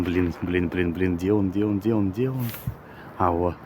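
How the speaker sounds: hushed, searching under the breath; wildlife watching whisper. Real, not acted. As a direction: keep it low so the bird stays. Creeping up on a falcon.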